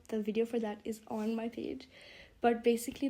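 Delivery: ordinary talk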